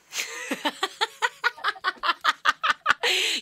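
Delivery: long, high burst of laughter; real prolonged belly laugh; hearty laugh. Real, not acted. Host laughs at caller's confession.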